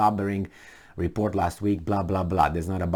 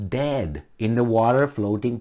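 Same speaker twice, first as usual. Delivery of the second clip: ordinary talk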